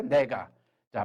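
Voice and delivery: ordinary delivery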